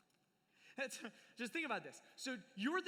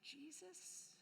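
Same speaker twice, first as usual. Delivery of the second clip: hushed, higher, tentative; timid hushed question; 6 semitones higher, 12 dB quieter, slower than usual. Retold or imitated. Martha nervously telling sulking Mary Jesus came. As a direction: tiptoe into it nervously.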